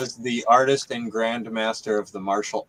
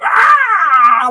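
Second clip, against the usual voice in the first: loud, very high sustained scream; imitated scream; 11 dB louder than usual. Retelling Bruce Lee ripping chest hair out, loud mimicked scream. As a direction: let out a long high mimicked wail. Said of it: "and he's like".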